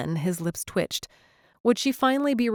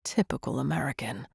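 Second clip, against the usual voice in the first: low, dry muttered jab; a hint of Liam's British scorn; lightly voiced Liam; 5 semitones lower, 5 dB quieter than usual. A narrator's reading. Liam needling Claire about Americans, dry and low.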